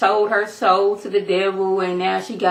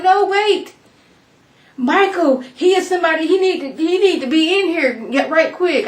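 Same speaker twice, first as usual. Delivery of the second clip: urgent interruption; 9 semitones higher than usual. Acted. Acted urgent interruption of the killing.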